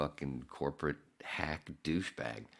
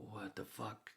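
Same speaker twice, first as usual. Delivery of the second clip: muttered what the fuck; 6 semitones higher, 10 dB quieter, slower than usual. Retold or imitated.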